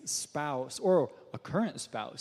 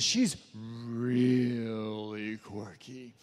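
very slow, lower southern drawl, imitating her mom; 6 semitones lower, much slower than usual. [name] imitating Charity's mom warning him, slow southern drawl. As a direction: stretch "She's really quirky" out in a thick southern drawl. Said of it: "she's got this really southern drawl".

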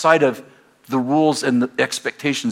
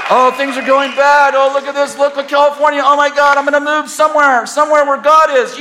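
mock worried believer; 12 semitones higher, 6 dB louder than usual. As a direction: ham up the panicking churchgoer. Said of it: "mocking fearful believers".

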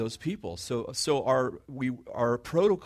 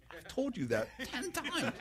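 exasperated wife; 14 semitones higher, 6 dB quieter than usual. Retold or imitated. Wife tells husband she said it already, high exasperated squeal. Wife's exasperated retort in anecdote.

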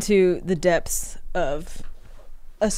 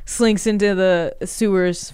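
ordinary delivery

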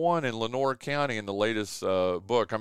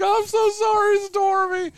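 much higher, louder cooing to a pet; high-pitched pet apology; 7 dB louder, slower than usual. Retold or imitated. Owner apologizing to the cat, high-pitched.

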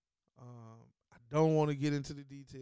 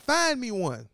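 loud demand; 8 semitones higher, 8 dB louder, slower than usual. Real, not acted. Repeated demand.

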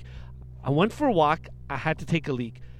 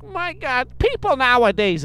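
put-on old lady; 4 semitones higher, slower than usual. Old lady gets the key back, high disapproving voice. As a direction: imitate a scandalised old lady, pitched up. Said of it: "And she's like".

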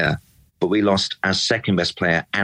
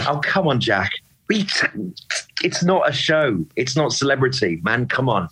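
exasperated; 5 semitones higher than usual. Real, not acted. Exasperated rant at absent player.

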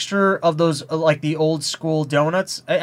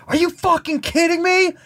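high-pitched outraged yell, not full volume; retold scream; 13 semitones higher than usual. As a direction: re-enact screaming at another driver, pitch way up. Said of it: "I scream at the top of my lungs".

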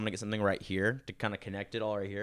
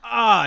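sudden loud, high "ah" of pain; pained yelp; 13 semitones higher, 13 dB louder than usual. Retold or imitated. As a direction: re-enact a sharp cry of pain. Retelling pain as friend presses again.

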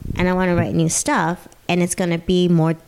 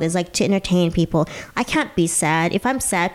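usual speaking voice

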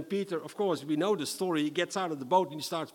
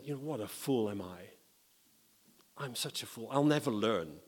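soft regretful sigh; 4 semitones lower, 5 dB quieter than usual. Acted. Peter at home, regretful.

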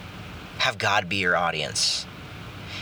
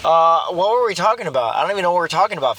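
louder, higher and fast, losing the thread; flustered and excited; 7 semitones higher, 6 dB louder, faster than usual. Real, not acted. Podcast host realizing he rambled, louder, higher, fast. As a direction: rattle it off, caught out and amused.

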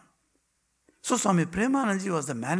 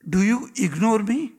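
raised, louder challenging question; reproachful call; 5 semitones higher, 8 dB louder than usual. Acted. Ramakrishna calling out to Prabhupada, raised. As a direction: call him out, half hurt.

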